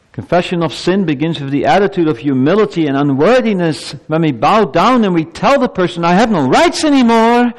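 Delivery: high, swelling sermon voice; impassioned preaching. Real, not acted. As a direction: build upward as you drive the point home. Preaches at full force.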